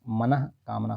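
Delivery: मनः कामना slowly with a clear ह after मनः; careful pronunciation. Shown as a demonstration. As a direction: stretch मनः before कामना. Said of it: "वर्तनी शुद्धि की बात आएगी तो".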